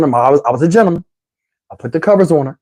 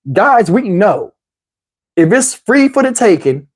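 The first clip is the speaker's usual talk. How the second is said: normal talking voice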